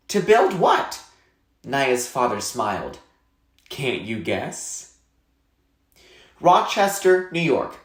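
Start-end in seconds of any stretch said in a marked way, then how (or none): 0.1-1.0 put-on curious girl. Naya asks her father, curious. 3.7-4.9 gentle tease. Acted. Smiling father teases his daughter.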